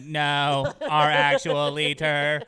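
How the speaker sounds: drawn-out booming alien proclamation. Acted. Alien names Trump as leader.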